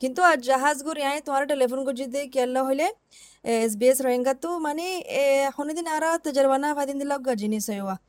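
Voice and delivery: usual speaking voice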